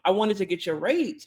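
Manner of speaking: ordinary talk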